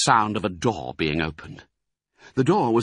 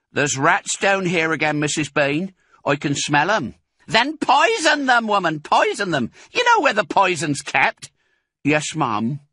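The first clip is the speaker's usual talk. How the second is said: woman voice; 9 semitones higher, 5 dB louder than usual. A narrator's reading. Women calling between floors.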